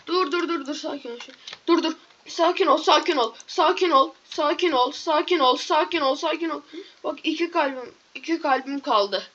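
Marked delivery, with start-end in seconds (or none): none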